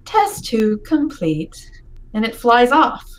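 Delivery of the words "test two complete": slow, flat machine voice; monotone robot voice. Acted. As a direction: drone it like a robot orb. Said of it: "it says in a monotone voice".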